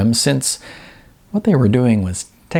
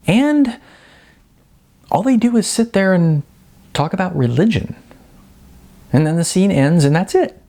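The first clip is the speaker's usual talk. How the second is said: Jasmine's voice; 9 semitones higher than usual. Acted. Jasmine's higher character voice.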